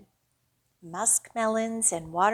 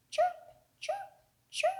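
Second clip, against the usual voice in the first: very high chirping bird sound; squeaky high bird call; 5 dB quieter than usual. Pretend. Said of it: "Hear its funny sound".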